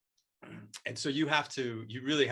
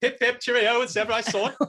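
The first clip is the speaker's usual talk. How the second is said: put-on high, loud, silly British toff accent; mock British accent; 11 semitones higher, 10 dB louder than usual. Guest carrying on the accent joke, mock British accent. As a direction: cheer in a hammed-up British accent.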